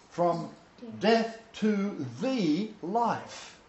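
slow, high-pitched preacher's declamation; emphatic scripture recitation. Acted. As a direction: draw out each phrase of the verse, pausing between them.

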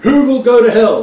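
loud, high, thundering imitation; hammed-up hellfire condemnation; mock hellfire preacher. Teacher imitating a condemning article writer, mock hellfire preaching.

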